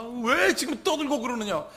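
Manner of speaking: loud, high-pitched acted scolding; thundering rebuke. Jesus scolding the crowd at the house, thundering rebuke. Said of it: "호통치십니다".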